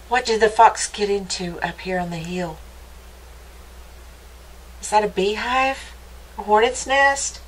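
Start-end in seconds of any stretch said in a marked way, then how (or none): none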